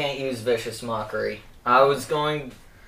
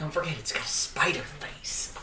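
whisper; 11 dB quieter than usual. Real, not acted. Whispered aside.

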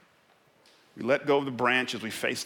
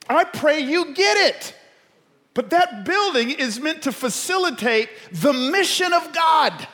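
loud, high-pitched sermon at full force; impassioned preaching; 13 semitones higher, 8 dB louder than usual. Real, not acted. Preacher urging the church toward its mission, impassioned. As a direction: drive it home to the whole crowd.

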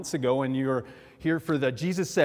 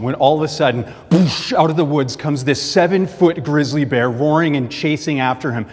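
louder, urgent narration of the bear charging out; building the scare for the congregation, loud and urgent; dramatic storytelling; 11 dB louder than usual. A narrator's reading. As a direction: punch the sudden attack hard. Sudden bear attack in joke.

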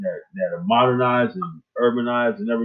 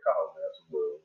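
low, hushed aside, imitating a confidant; imitated whisper; 8 semitones lower, 11 dB quieter than usual. Brother Jamal advising Kyle in his ear, mock whisper. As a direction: drop low and soft, as if into someone's ear. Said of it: "whisper in my ear".

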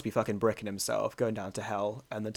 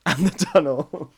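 laughing; 6 semitones higher, 7 dB louder, slower than usual. Real, not acted. Laughing repeat of friend.

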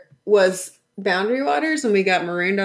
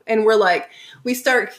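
ordinary talk

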